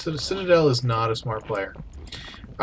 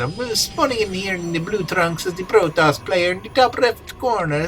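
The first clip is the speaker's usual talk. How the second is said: mock pirate accent, higher; 6 semitones higher than usual. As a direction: narrate the map like a swaggering pirate. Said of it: "that accent".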